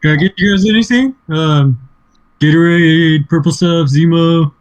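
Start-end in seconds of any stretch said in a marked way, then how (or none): 0.0-1.1 high, quick waiter voice; mock waiter. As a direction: play a chirpy server offering drinks. Playing a waiter offering drinks.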